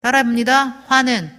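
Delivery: ordinary delivery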